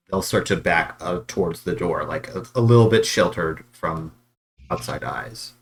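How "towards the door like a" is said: ordinary delivery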